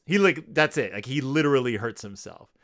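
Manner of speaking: normal talking voice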